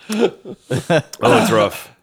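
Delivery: normal talking voice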